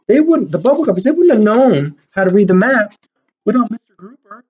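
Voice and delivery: ordinary delivery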